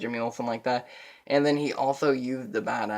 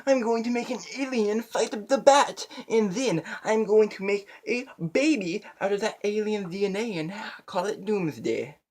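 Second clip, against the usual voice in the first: much higher, unhinged mad-scientist voice; mocking mad scientist; 10 semitones higher, 3 dB louder than usual. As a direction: ham up a crazed villain scheming. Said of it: "He's all like".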